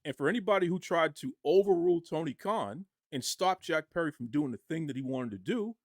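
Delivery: normal talking voice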